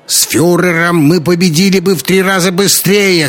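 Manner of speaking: loud, high cry from the crowd; high-pitched angry heckle; heckling shout. A narrator's reading. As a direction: yell out an objection. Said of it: "крикнул кто-то из стариков".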